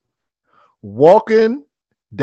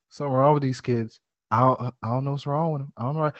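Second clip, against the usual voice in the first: put-on old man muttering; 4 semitones lower, 9 dB quieter, faster than usual.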